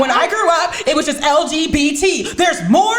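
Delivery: high, loud mock incredulity; exasperated mom. Acting out exasperated mother's rant.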